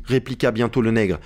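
ordinary delivery

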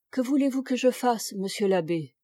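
plain reading voice

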